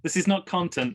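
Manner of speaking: ordinary talk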